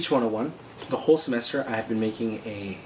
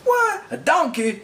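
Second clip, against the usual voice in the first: high, loud mock disbelief; incredulous friend; 6 dB louder, slower than usual. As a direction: imitate a stunned friend squawking in surprise. Retells friend's disbelief at donkey.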